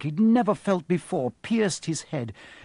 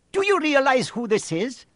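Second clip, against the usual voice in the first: much higher centaur voice, pressing; defiant centaur; 11 semitones higher, 4 dB louder than usual. A narrator's reading. Centaur defends carrying the boy, higher pressing voice. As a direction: challenge the other centaur. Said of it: "said Firenze".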